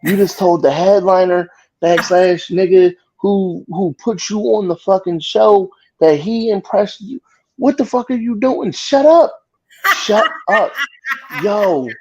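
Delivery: high, rising outrage in his head; exasperated disbelief. Retold or imitated. Comedian reliving his silent outrage at a cocky opener. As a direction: scream it inside at the clueless comic. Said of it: "I'm sitting there like".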